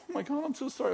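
squeaky high, flustered sorry; high panicked apology. Retold or imitated. Students apologising in panic to the teasing pastor, high and flustered. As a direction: play the mortified student pitched far up. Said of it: "They're like".

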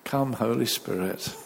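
ordinary talk

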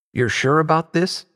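high-pitched teasing question; joking. A narrator's reading. Tabin teasing Marley before she teleports, joking. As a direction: tease with a lifted question. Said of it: "Tabin joked".

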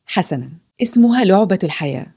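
ordinary delivery

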